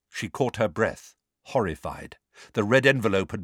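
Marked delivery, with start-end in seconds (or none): none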